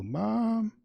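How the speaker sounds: put-on a child's high call, far off and weak; faint faraway voice. Son on a failing call, faint faraway voice. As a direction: call Mom as if from far away through a bad line. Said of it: "in some faint, weak, faraway voice".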